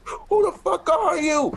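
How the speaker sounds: very high, frantic mock alarm; high-pitched hammed-up freak-out; mock panicked yell. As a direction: demand it in acted panic. Mario panicking at a stranger.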